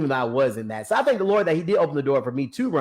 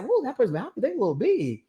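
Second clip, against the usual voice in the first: taken aback; 9 semitones higher, faster than usual. Retold or imitated. Retold reaction to the mirror.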